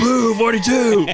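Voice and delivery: loud, high, slow shouted play call; mock shout. Shouting a quarterback call at the bit. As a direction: bark it out like a quarterback.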